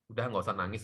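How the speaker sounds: ordinary delivery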